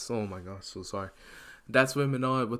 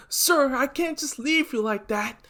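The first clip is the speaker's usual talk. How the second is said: desperate plea; 13 semitones higher than usual. Acted. Izuku refusing to leave teacher.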